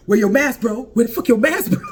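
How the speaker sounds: loud mock confrontation over a missing mask; mock shouted demand. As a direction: bark it like a bouncer in someone's face. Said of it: "he's like".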